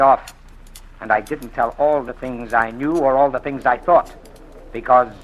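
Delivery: forceful threat. Acted. Blackmailer pressing Marcia with his secrets, forcefully loud. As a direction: lean on her with a menacing push.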